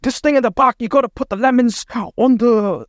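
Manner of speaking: high, hammed-up British chef accent; Gordon Ramsay British accent. Imitating a TV chef yelling at restaurant staff in a high British accent. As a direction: bark orders in a mock British accent like a TV chef. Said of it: "that's my British accent".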